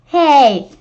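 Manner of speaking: loud, slow, drawn-out cry of protest; stretched-out outraged yell; angry shout. Acted. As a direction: yell it like your candy was just snatched. Kid's candy stolen, shouting.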